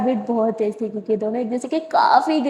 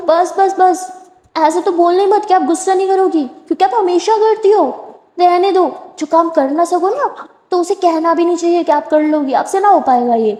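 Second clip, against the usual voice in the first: louder, higher exasperated scolding; heated scolding; 5 semitones higher, 4 dB louder than usual. Acted. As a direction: cut her off firmly. Tara loudly scolding.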